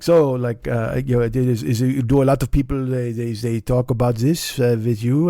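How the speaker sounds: stumbling mock French accent; tortured French accent. French passenger asking what riders discuss, tortured French accent. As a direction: fumble for English with exaggerated French accent. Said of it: "stop the tortured French accent now".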